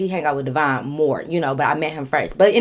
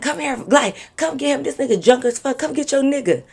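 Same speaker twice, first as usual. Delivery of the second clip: much higher, calling up to her from below; friend calling up; 9 semitones higher than usual. Retold or imitated. Drunk friend calling her down the stairs, high call. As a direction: hail her down the stairs to fetch her man.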